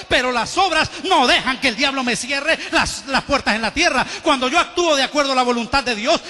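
loud, rising sermon voice pressing each point; fervent preaching. Acted. Pastor preaching on grace and works, loud fervent build. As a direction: hammer the truth home to the church.

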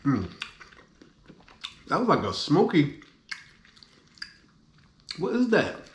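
chewing while talking; speaking between bites; mouth full. Real, not acted. Seen 0: Bites sushi at -3.0; closed mouth, chewing look through 6.0.

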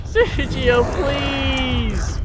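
high, loud, wailing begs; mock-tearful pleading. Stuck player begs the villain to come back, mock-crying pleas. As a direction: wail after them as if being abandoned, hammed-up. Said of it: "I'm literally crying".

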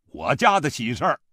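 stern, loud answer. A narrator's reading. Father-in-law answers a curious villager, stern and loud. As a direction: answer loudly with a stern face. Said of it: "板着脸大声说".

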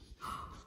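quiet shocked intake; soft gasp. A narrator's reading. Narrator reacts to Rachel not sharing, soft gasp. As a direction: gasp softly in dismay.

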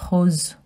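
rose as rohz, throaty r; French pronunciation. Shown as a demonstration. Teaching children rose. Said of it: "Pink in French is".

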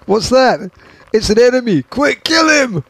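high, loud pretend panic through giggles; hammed-up urgent cry, on the edge of laughter; mock alarm. Host pretends to spot an enemy, mock alarm.

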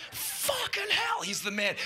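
mock awestruck fan; awed fan. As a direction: gush like a stunned fan. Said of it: "Everyone's like".

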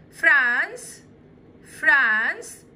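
فرانس said loud and clear with a rising tune; teacher calling out the country name; model word. Shown as a demonstration. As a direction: call out فرانس firmly, rising at the end. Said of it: "تعالوا نشوفهم كده".

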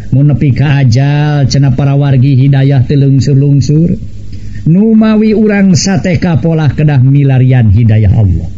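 ordinary delivery

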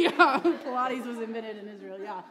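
laughing through the words. Real, not acted. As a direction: answer a joke while still giggling. Laughing at listener's joke.

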